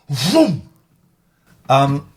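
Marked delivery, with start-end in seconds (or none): 0.1-0.6 shouted engine vroom; loud vroom. Pretend. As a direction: belt out a vroom like a revving engine. Kicking trolls out of chat.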